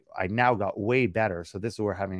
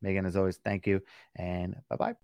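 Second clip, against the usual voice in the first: normal talking voice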